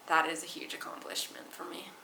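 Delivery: whispered proud aside. Real, not acted.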